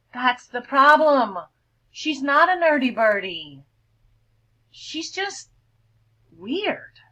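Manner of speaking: loud, slow, high sneering voice; mocking cool bird. Cool bird mocking the vulture, loud and sneering. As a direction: sneer at the weird newcomer.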